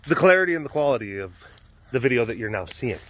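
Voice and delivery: ordinary talk, nothing marked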